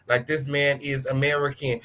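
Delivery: ordinary delivery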